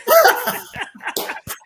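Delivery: a real, delighted high laugh; sudden high happy laugh; high burst of laughter. Real, not acted. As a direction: laugh out loud with joy at hearing his friend. Greets old teammate who called in.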